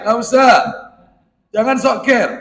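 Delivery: ordinary talk, nothing marked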